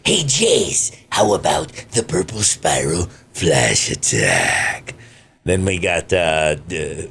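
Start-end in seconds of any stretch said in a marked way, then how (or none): 0.0-4.9 lightly voiced alien soldier, slow at the end; a slow, drawn-out villain pitch, only lightly put on; Berter voice. Shown as a demonstration. Berter pitching an attack to Jace, in a lightly voiced slow villain voice. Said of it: "Berter from the Ginyu Force, which is".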